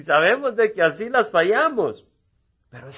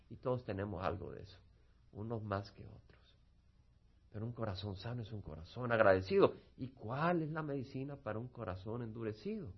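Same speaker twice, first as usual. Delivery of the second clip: soft reflective close; 6 semitones lower, 11 dB quieter than usual. Acted. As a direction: gently urge the congregation inward. Cierre suave y reflexivo del sermón. (exhortación suave y baja)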